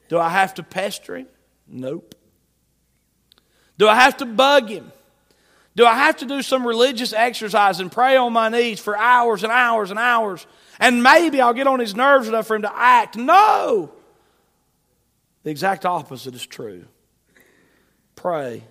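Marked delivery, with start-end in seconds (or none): 3.8-13.9 high, loud rhetorical questions climbing to a shout; fiery preaching. Acted. Preacher rejecting the idea of pestering God, fiery preaching. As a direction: build in force, then explode on the last word.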